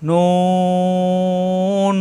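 "নুন" held very long, lips rounded; four-alif stretch. Shown as a demonstration. Teacher demonstrates four-alif length, very long stretch. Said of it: "চারালি পরিমাণ".

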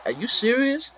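can't believe what the opponent just did; exasperated disbelief. Real, not acted. Frustrated gamer reacting to onside kick.

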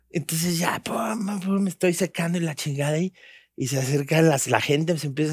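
soft and breathy, pulling friends in close; hushed storytelling. Retold or imitated. Telling friends about the ride, in a hushed near whisper. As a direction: drop to a near whisper for the build-up.

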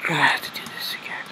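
fast defeated whisper; quick exasperated whisper. Real, not acted. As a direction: breathe it out under your breath. Muttering at having to redo.

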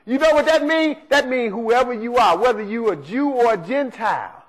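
ordinary talk, nothing marked

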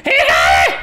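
loud cry, half laughing; shouted dismay. Real, not acted. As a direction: yell that you're caught. Caught by the monster.

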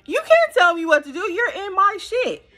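high, loud mock-defiant comeback; indignant retort. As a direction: fire back, daring him. Retorting to boyfriend's demands.

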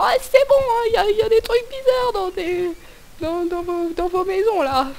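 high whiny quitting voice; mock complaining worker. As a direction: whine it like a fed-up employee. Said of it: "Je vais dire à mon employeur".

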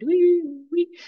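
high, loud siren wail; imitated alarm bells. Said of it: "some alarm bells going off".

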